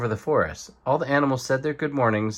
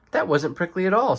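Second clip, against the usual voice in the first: pleased surprise; 7 semitones higher than usual. Acted. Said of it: "said Otter".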